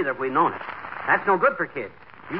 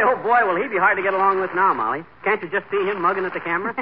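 ordinary talk, nothing marked

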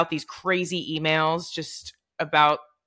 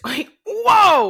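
shocked whoa; 13 semitones higher, 8 dB louder than usual. Real, not acted. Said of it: "laughing shock at teacher".

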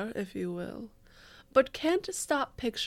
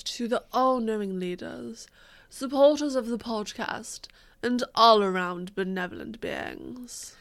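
grand announcer; 4 dB louder than usual. Acted. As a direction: announce like a herald. Ceremonial supporter praise.

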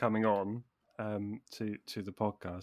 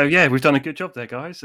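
loud, hearty pat on the back; mock self-congratulation; 4 semitones higher, 13 dB louder than usual. Host mock-praising her co-hosts, loud and hearty. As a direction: cheer the team on like a proud host. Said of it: "and then I go".

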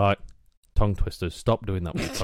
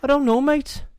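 very high, loud put-on Scouse; squeaky exaggerated Liverpool accent; 4 dB louder than usual. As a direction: do a high-pitched comic Scouser. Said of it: "were that a Scouser one".

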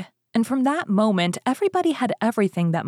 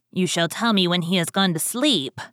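ordinary delivery, nothing marked

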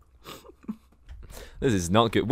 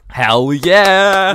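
excited cheer; 9 semitones higher, 10 dB louder than usual. Real, not acted. Hyped podcast return cheer.

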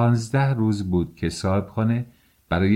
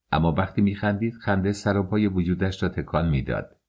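usual speaking voice

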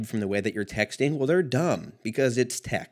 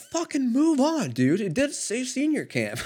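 annoyed viewers. Retold or imitated. Mocking annoyed viewers who want him to move on, high mock voice. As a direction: mimic impatient fans telling you to move on. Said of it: "They're like".

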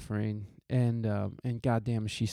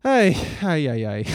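hammed-up Mexican-accented wail of dismay; terrible Mexican accent; 3 semitones higher, 6 dB louder than usual. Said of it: "that's my terrible Mexican accent".

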